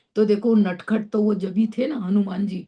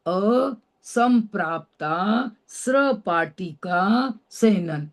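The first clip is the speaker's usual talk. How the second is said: each syllable of असंप्राप्ता स्रपाटिका drawn out slowly and clearly; slow careful spelling-out; much slower than usual. Shown as a demonstration. Teacher spelling out a hard name for students, slow and careful. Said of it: "छठे number का नाम बड़ा कठिन है".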